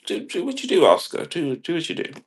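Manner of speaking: high, light mock director voice; put-on high voice, lightly approving; indulgent director. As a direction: wave the actor on airily. Voices director waving actor on.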